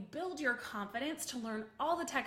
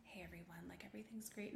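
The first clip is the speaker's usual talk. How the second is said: soft whispered low-energy greeting; mocking timid whispered greeting to viewers; 4 semitones lower, 14 dB quieter, faster than usual. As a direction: mimic a shy, timid live host in a whisper. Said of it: "It's like".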